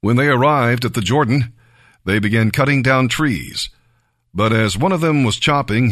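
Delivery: plain reading voice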